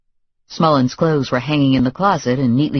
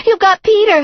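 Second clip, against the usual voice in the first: much higher and louder, near a scream; shrieking accusation; 7 dB louder than usual. A narrator's reading. Girlfriend screaming at the visitors, panic peaking.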